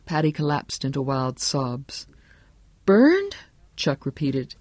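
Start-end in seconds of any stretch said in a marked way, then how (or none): 2.8-3.4 high, rising disbelief; stunned self-questioning. A narrator's reading. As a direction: repeat the word as a baffled question. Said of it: "He seemed to be asking himself now".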